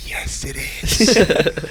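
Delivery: drawn-out whispered confirmation after a gasp; slow, breath-only mock reveal; conspiratorial whisper. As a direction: imitate a friend whispering a big secret. Friend confiding a fake secret.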